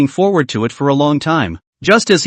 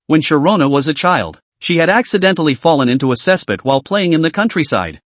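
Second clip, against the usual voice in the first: plain reading voice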